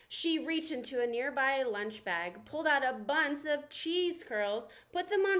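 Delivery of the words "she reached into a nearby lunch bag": plain reading voice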